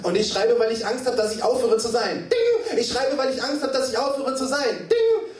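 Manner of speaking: high, driving chant-like refrain; insistent high recitation; urgent slam refrain. Acted. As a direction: punctuate with piercing typewriter dings. Performs poem refrain.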